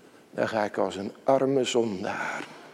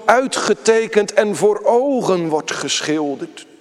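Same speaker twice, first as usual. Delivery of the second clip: loud, high, driving pulpit delivery; preaching at full force; 6 semitones higher, 5 dB louder than usual. Acted. Preacher proclaiming the gospel to the congregation, loud and high. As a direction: preach it out, building with each clause.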